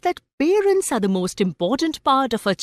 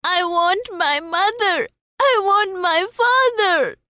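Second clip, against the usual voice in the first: high, tearful insistence; crying refusal; 9 semitones higher than usual. Acted. Child refusing the roundabout, crying for his parents. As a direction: refuse and cry out for your parents. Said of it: "The child refused and cried".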